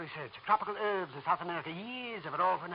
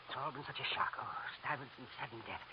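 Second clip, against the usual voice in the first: ordinary talk, nothing marked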